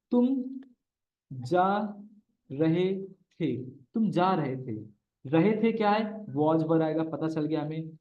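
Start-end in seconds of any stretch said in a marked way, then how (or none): 0.1-3.6 slow, word by word with long pauses; slow dictation. Shown as a demonstration. Teacher setting a sentence for students, slow. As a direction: drop तुम जा रहे थे one word at a time. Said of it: "teacher dictates sentence slowly".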